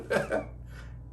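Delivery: a squeaky breath of a laugh; very high airy laugh. Real, not acted. Guru laughing about birds hunting food, squeaky laugh.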